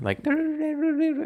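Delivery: very high trilled synth noodling; imitated keyboard melody. Shown as a demonstration. Describing a friend's psytrance track, imitated keyboard. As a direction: mimic a keyboard riff with the voice. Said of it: "imagine someone playing on a keyboard".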